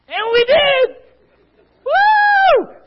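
high, loud whoop; mock victory shout; triumphant shout. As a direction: yell it like a victory. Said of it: "disciples cheering success".

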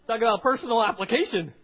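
full-force preaching shout. Real, not acted. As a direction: bellow it out.

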